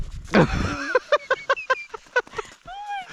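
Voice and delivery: high, breathless laughing burst; loud laughter. Real, not acted. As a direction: crack up laughing. Friend just fell on trail.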